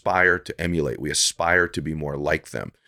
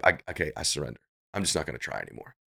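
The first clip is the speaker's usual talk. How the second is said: a soft, quiet giving-up mumble; resigned surrender; 3 semitones lower, 8 dB quieter, faster than usual. Retold or imitated. Quitter admitting defeat to himself, quiet and resigned. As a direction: let the fight drain out of the words.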